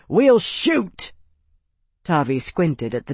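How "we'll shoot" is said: high, loud threat; nervous sentry threatening to shoot; called threat. Acted. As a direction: shout the warning down at them. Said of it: "called the Legionare's voice".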